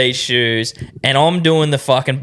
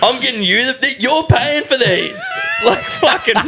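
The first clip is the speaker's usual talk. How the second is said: laughing; 10 semitones higher than usual. Real, not acted. Laughing retelling wife switching sides.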